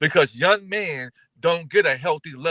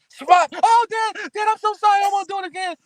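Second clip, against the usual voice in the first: fake scream. Son faking pain for his dad's belt. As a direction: pretend to be whipped, wailing. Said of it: "scream like I'm hitting you".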